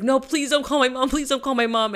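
high, loud mock pleading. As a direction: beg her not to call, half-panicked. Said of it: "And I was like".

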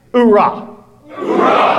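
high loud battle cry; shouted hoorah. Shown as a demonstration. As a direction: bark it out like a Marine. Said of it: "Why don't you say that for me?".

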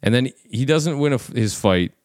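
normal talking voice

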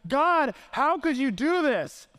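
high, slow retold protest to God; frustrated raging. Preacher shakes his fist at God, angry and frustrated. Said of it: "I was raging against the sky".

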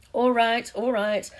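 exasperated backing down; grudging surrender. Acted. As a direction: give in under protest. Commentator gives in to professor.

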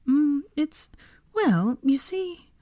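high, slow, nervous hedging; hesitant, flustered dodge; nervous stalling. A narrator's reading. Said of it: "Hermione chuckled nervously".

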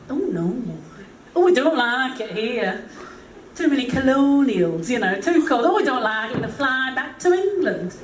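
put-on whingeing British old relative; pessimistic British uncle. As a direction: moan like a gloomy British uncle. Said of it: "had the gift of pessimism".

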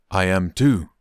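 usual speaking voice

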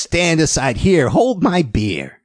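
high, slow imitation of the biker; squeaky voice. Biker about to show off at an audition, squeaky mock voice. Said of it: "kind of a squeaky voice".

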